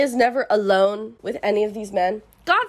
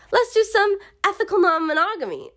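1 mock paraphrase; 9 semitones higher than usual. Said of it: "He's like".

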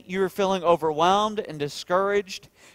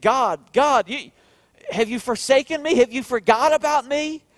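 higher, louder cry to God; anguished plea; 8 semitones higher, 5 dB louder than usual. Retold or imitated. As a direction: plead like a forsaken psalmist, raised voice. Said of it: "David had those moments where he said".

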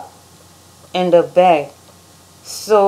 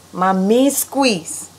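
slow drawl; much slower than usual. Acted.

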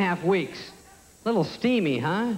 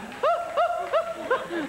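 high-pitched panicky hoots; hammed-up high freak-out noises; freaking-out panting. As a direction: hoot in mock panic. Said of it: "I'm like freaking out".

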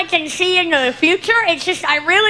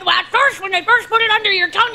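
ordinary talk, nothing marked

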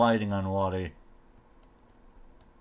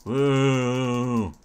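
long held airborne woo; playful woo; 3 dB louder than usual. Pretend. Player leaping about in spider armour, long playful woo. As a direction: sustain a gleeful hoot.

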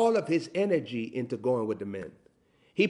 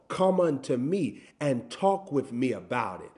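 normal talking voice

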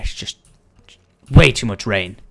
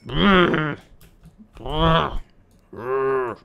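angry growl; much slower than usual. Pretend. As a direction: growl in mock rage, then ease off. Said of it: "I'm so angry".

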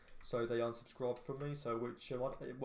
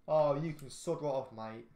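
annoyed telling-off; 3 semitones higher, 7 dB louder than usual. Real, not acted. Yelling at another driver in game.

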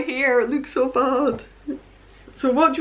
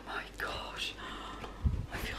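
whispering; 19 dB quieter than usual. Real, not acted. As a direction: breathe it out in awe. Mum overwhelmed by the surprise.

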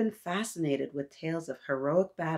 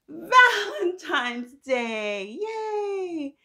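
high, loud and drawn-out cheer; excited cheer; 11 semitones higher, 5 dB louder, much slower than usual. Real, not acted. Teacher cheering Valentine's Day to her listeners, high excited cheer.

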